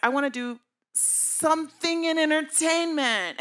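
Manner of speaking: put-on high, loud, eager young voice; mock-earnest teen dream. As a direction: gush it like a hopeful teenager. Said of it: "And I was like".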